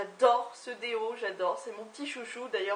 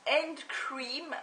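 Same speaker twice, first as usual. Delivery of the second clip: higher-pitched, showy English accent attempt; self-mocked English accent; 3 semitones higher than usual. Shown as a demonstration. Reading the hand cream's name, in mock English accent. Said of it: "mon super accent".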